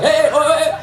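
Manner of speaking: very high excited hooting; string of high-pitched yelps; teasing janitor. Retold or imitated. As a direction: imitate João's squeaky teasing hoots. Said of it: "he's like".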